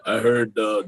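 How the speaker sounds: ordinary delivery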